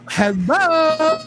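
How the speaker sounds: high, loud mock camp voice; mocking the effeminate voice, loud and high. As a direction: ham up a lisping camp greeting. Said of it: "I used to talk like this".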